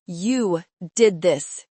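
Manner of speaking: slow, loud bitter hiss. A narrator's reading. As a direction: spit it out slowly with hate. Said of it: "he hissed at me".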